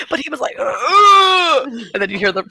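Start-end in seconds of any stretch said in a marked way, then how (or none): 0.6-1.7 loud, high straining groan; mock agonised bathroom groaning; hammed-up effortful moan; imitated groan. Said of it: "the guy groans again".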